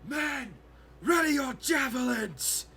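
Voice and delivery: rally the troops with a war cry; battle command. Acted. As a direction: orc leader ordering her men to attack, shouting. Orc commands her troops.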